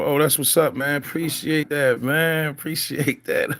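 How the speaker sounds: light up and thank them; excited thanks. Real, not acted.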